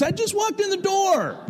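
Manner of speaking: imitating grumpy daughter. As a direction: play the fed-up daughter just home from school. Daughter snaps back at teasing dad.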